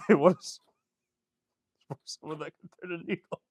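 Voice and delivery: laughing through the words. Real, not acted. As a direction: breaks into a laugh. Laughing mid-rant.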